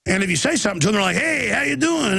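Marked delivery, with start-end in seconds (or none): 1.2-2.2 mock cop greeting.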